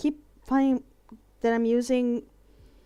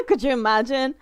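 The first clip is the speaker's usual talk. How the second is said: higher, louder, through laughter; laughing; 5 semitones higher, 13 dB louder than usual. Real, not acted. Picturing a Scooby-Doo moment, laughing. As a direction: crack up while saying it. Cracking up at imagined scene.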